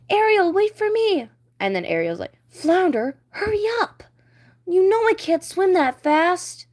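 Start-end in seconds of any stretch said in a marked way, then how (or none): 0.1-1.3 high anxious call; calling Flounder. Acted. Flounder calls to Ariel, high and anxious. 2.5-6.7 higher playful call; Ariel calling. Acted. Ariel teasing Flounder to hurry, higher playful voice.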